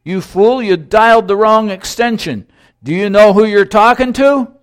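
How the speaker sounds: loud, high, outraged scolding; acted telling-off of an underling; indignant response. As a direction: raise the voice, affronted and self-important. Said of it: "The voice in the other end responded".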